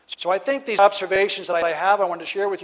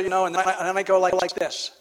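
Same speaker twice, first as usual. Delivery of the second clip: ordinary talk, nothing marked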